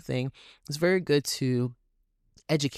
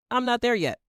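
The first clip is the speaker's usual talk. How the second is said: up high and loud, I'm not there yet; emphatic outburst; 9 semitones higher, 5 dB louder than usual. Real, not acted. Vlogger insisting she can't forgive yet, raised and loud. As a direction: blurt it out hot.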